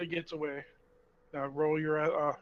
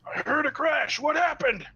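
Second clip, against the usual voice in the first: high, loud startled question; urgent in-character shout; alarmed call; 10 semitones higher, 7 dB louder, slower than usual. Acted. Character calling out after the cave-in.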